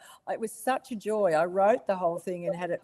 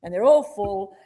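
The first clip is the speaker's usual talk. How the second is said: ordinary delivery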